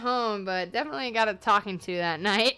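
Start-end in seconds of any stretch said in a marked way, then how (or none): none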